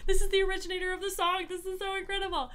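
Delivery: high, fast gushing fan voice; excited crowd. Acted. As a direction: squeal it out like a starstruck fan, rushing. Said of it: "And everyone's like".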